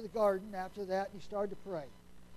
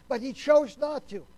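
preaching swell; 3 semitones higher, 9 dB louder than usual. Real, not acted. Preaching climax.

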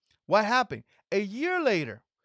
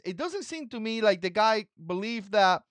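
ordinary talk

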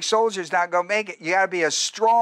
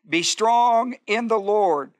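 preached refrain; 3 semitones higher, 3 dB louder, slower than usual. Acted.

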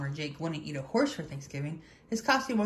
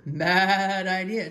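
loud, stretched-out "bad"; rooster; 6 dB louder, much slower than usual. A narrator's reading. Rooster scolding disguised Turkey, loud and drawn out. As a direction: draw it out slowly and loudly. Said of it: "crowed Rooster".

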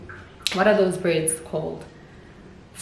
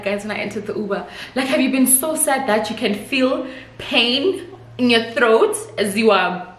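ordinary delivery

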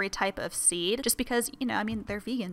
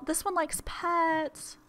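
cutesy pet voice; 7 semitones higher, much slower than usual. Real, not acted. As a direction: coo at the bunny who likes pets. Cooing over the rabbit.

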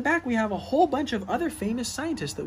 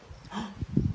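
a sharp unvoiced gasp of wonder; excited gasp; 3 dB quieter than usual. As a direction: gasp as if revealing a surprise. Dramatic gasp before each scientist.

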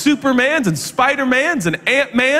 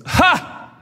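mocking scoff; 7 semitones higher than usual. Cousin scoffing at the movie.

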